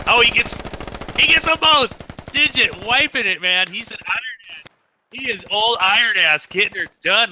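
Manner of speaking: high, thrilled play-by-play yell; excited shout. Real, not acted. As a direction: call the double kill at full hype. Caster erupts at double kill.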